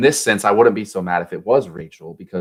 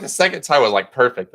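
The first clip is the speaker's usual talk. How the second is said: normal talking voice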